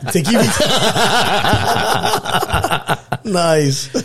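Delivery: laughing out loud while talking; high, loud laugh through the words. Real, not acted. Laughing at the EBT joke.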